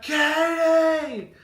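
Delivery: high, loud drawn-out call; hollering. Retold or imitated. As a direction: holler a name across the yard. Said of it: "I just hollered".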